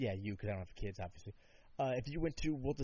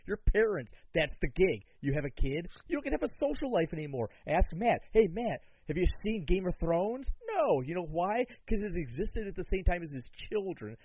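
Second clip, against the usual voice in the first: loud, indignant comic scolding; mock outraged rant; 4 semitones higher, 5 dB louder than usual. Comic telling parents they lose their social life, mock outraged. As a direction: lay into them, loud and exasperated.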